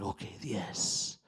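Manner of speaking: slow, soft whisper of the ceremony guide. Retold or imitated. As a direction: breathe the words out slowly, barely voiced. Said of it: "And he's like".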